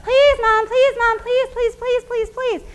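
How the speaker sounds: put-on high, loud, pleading kid voice on repeat; begging child. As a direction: beg like a spoiled kid at the checkout. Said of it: "you've seen the kids who say".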